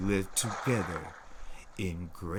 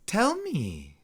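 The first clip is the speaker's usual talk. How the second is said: eager; 12 semitones higher than usual. A narrator's reading. Sister eager.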